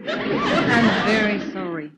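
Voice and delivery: slow, giggling apology; laughing apology. Acted. Woke him at night.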